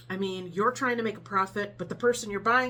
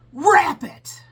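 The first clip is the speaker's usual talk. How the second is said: sharp, much louder and higher bark of a command; retold shout; 8 semitones higher, 14 dB louder than usual. Rude customer yells at the cashier: loud barked order. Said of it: "about a foot from my face".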